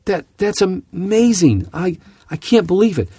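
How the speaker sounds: hammed-up thrilled investor; imitating gushing caller. Pilot mimics instructor hearing a stock tip, hammed-up thrill. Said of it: "you could hear him saying".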